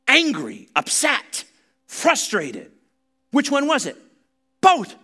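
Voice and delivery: high, loud, full-force preaching; fiery preaching. Acted. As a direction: preach it hard, building to a cry.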